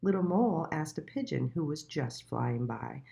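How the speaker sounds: ordinary delivery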